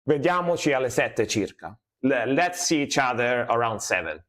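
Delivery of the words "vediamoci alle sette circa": Vediamoci alle sette circa in fluent Italian; Italian sentence, natural native pronunciation. Shown as a demonstration. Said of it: "This will be in Italian".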